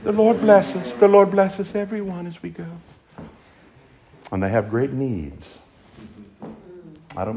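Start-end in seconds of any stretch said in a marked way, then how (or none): 0.0-2.7 put-on high little child-like voice, fading away; Tiny Tim prayer. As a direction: mimic a meek Tiny Tim blessing. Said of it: "the Tiny Tim prayers".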